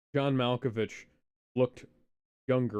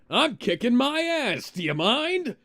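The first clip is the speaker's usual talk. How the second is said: movie quote impression; 11 semitones higher, 8 dB louder than usual. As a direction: belt the quote like the film. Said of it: "quoting Liar Liar".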